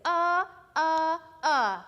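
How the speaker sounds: "Uh, uh, uh" punched short and loud; letter sound drill. Shown as a demonstration. Teacher modelling the short U sound. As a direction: bark out "uh" three times like a drill. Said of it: "you would say".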